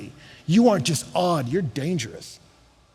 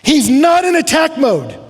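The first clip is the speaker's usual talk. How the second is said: building preacher rant; 9 semitones higher, 7 dB louder than usual. Acted. Preacher mocks social media pile-ons with a loud building rant. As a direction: pile it on, louder each time.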